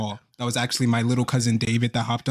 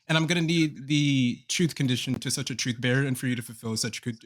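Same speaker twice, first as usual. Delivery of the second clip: usual speaking voice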